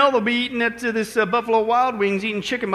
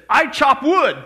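higher, emphatic mock intern voice; intern's proud declaration; 4 semitones higher, 4 dB louder, slower than usual. [name] answers the general's skills question, emphatic and higher. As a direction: announce it firmly as the intern.

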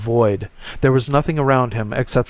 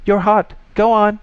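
high, loud excited shout; insistent high-pitched shouting; 10 semitones higher, 6 dB louder than usual. A narrator's reading. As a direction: urge them on at raised pitch and volume. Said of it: "the voice shouted".

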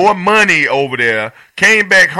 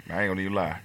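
quiet retold line; 8 semitones lower, 12 dB quieter than usual. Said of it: "was like".

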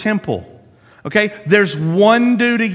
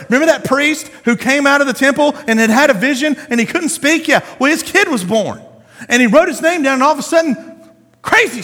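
pitched higher and quick with excitement; excited retelling; 8 semitones higher, 3 dB louder, faster than usual. Real, not acted. Preacher reminding the congregation of the priest's story, excited. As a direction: rattle off the recap with rising energy.

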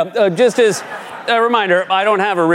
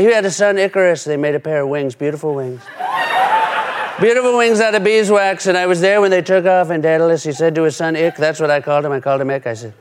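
Trump impression. Trump voiced telling Icarus tale.

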